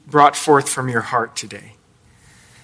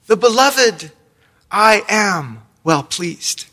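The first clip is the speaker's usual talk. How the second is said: high, solemn divine voice; voice of God; 5 semitones higher, slower than usual. Retold or imitated. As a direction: proclaim it like a voice from heaven. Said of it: "and then this voice saying".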